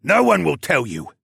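defiant prisoner in character; defiant captive. A narrator's reading. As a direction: spit defiance at your captor. Captive defies his captor.

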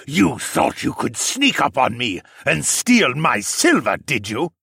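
slow, menacing roar. A narrator's reading. Accusing a sneak of stealing silver, a slow roar. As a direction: roar an accusation at a cornered thief. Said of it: "the other voice roared".

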